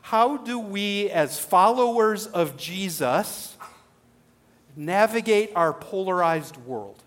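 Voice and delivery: slow, deliberate question; slow key question. Shown as a demonstration. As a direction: weigh each phrase. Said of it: "I have a question".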